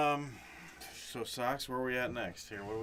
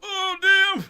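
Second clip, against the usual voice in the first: put-on high, startled old geezer; old man voice; 12 dB louder than usual. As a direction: yelp it like a frail old man. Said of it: "How many old fuckers do you think were like".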